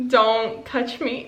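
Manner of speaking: usual speaking voice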